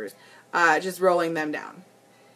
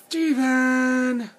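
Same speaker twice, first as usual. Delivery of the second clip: higher, drawn-out call of a name; meme girl impression; 5 semitones higher than usual. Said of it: "I'm like that girl in the meme".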